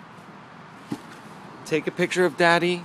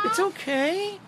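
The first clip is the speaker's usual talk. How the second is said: coaxing a wild bird. Real, not acted.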